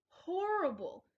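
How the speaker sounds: horrible sung out high and loud; dramatic emphasis. A narrator's reading. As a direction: lean hard into horrible, high then falling. Refrain read aloud.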